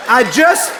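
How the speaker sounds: usual speaking voice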